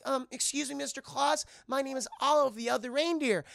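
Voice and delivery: high, polite little character voice; timid Olive. Retold or imitated. Olive introducing herself to Santa, timid. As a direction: pipe up meekly and sweetly.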